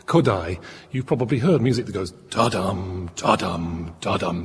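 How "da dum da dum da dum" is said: "da-dum" voiced as a repeated rhythmic figure, front-stressed; characteristic Hungarian rhythm. Shown as a demonstration. Presenter demonstrates Hungarian music's rhythm, "da-dum" chanted as a rhythmic figure. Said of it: "That kind of rhythm is very characteristic".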